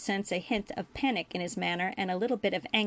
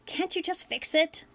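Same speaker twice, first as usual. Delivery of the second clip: much higher-pitched pleading character voice; impatient Ferengi boy; 10 semitones higher than usual. A narrator's reading. Ferengi boy interrupts the doctor, impatient high voice.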